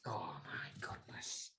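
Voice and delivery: breathless horrified whisper; shocked whisper. Real, not acted. Reacting to co-host ordering everything, a stunned whisper. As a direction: gasp it out under your breath.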